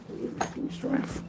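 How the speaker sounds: whispered, slow plea; praying for patience through teeth. Real, not acted. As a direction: breathe it out, begging. Exasperated with child, praying.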